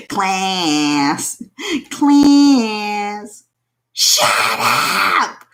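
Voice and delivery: slow, drawn-out weary nun hushing a class; a nun silencing a class, then a flat thank you. Retold or imitated. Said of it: "Sister Mary Elephant".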